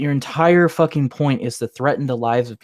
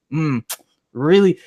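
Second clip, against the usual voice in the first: ordinary talk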